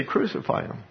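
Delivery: breathy whispered crowd demand, voice dropped right down; hushed retold crowd cry. Preacher retelling the crowd's demand, in a whisper. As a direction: let it fall to a whisper as the mob.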